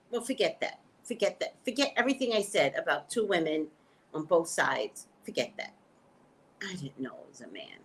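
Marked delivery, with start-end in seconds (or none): 5.2-7.8 sheepish whispered aside; embarrassed whisper. Real, not acted. Whispering to viewers after mistaking a man for a woman. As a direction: whisper it like you got caught out.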